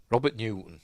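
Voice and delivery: ordinary delivery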